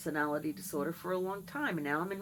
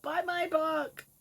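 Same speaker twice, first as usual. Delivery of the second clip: high, slow put-on plug; mock pleading for a sale; silly sales pitch; 10 semitones higher, slower than usual. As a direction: drag it out in a goofy voice. Plugging her book.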